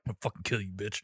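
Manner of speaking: low, quiet killer Santa threat; menacing mutter. Retold or imitated. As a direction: growl it under your breath. Santa threatening under his breath.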